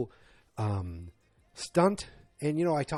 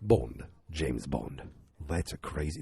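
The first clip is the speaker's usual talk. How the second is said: put-on much lower, very slow suave English accent; James Bond impression; 9 semitones lower, 3 dB quieter, slower than usual. Reviewer doing Bond's famous intro, low slow mock English accent. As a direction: draw out the name low and smooth like Bond. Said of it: "I can do better".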